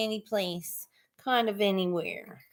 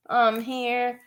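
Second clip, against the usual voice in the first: usual speaking voice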